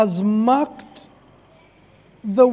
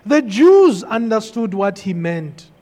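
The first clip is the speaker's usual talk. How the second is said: ordinary delivery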